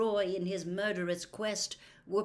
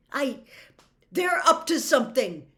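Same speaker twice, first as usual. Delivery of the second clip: loud, higher clenched insistence; angry; 7 semitones higher, 12 dB louder than usual. Acted. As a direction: spit it out angrily. Harry angry, fists curled.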